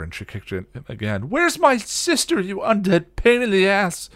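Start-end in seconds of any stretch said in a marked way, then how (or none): 1.3-4.1 high, loud furious yell; enraged shouted demand; angry. A narrator's reading. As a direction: yell the accusation in rage. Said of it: "only served to make her angrier".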